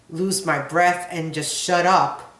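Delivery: ordinary talk, nothing marked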